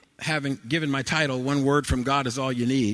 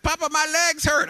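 put-on high, loud whine of a tired toddler; whiny little boy; 5 dB louder than usual. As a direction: play the worn-out grandson begging. Grandson complaining, voiced by preacher.

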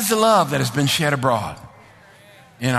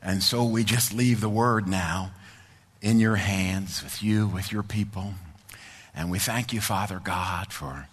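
low, soft, unhurried prayer voice; closing prayer; 10 semitones lower, 7 dB quieter than usual. Real, not acted. Pastor praying softly to Father God for the listeners. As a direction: pray gently over the congregation.

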